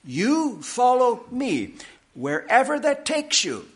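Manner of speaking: "You", "follow", "me" each slow and separately weighted; emphatic. Retold or imitated. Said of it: "It's very emphatic".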